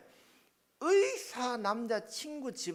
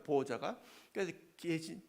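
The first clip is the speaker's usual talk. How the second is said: ordinary talk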